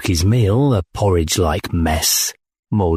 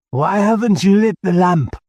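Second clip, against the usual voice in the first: put-on drunk commander; 11 semitones higher than usual. A narrator's reading. Drunk commander demands lamp.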